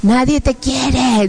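very slow, drawn-out taunt; imitating a demon's slow accusation; horrible demon voice. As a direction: draw the words out like a tormenting demon. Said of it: "unas voces horribles que decían".

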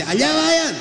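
very high call from far off; the Lord calling. Acted. The Lord directs the disciples from the shore, high call. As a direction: lift it up and out across the water.

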